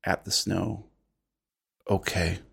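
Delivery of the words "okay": whisper. Acted. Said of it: "I'm just gonna whisper".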